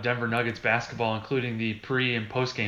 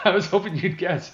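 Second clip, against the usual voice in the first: laughing; 6 semitones higher than usual. Real, not acted. Laughs while admitting.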